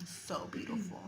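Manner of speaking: soft breathless whisper; hushed whisper of admiration; awed whisper. Real, not acted. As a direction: breathe it out in quiet wonder. Marveling at the snow.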